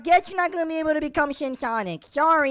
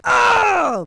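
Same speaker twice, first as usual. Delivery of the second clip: loud effort grunt; 3 semitones higher, 6 dB louder than usual. Acted. Kid firing attack.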